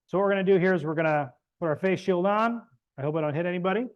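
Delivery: ordinary talk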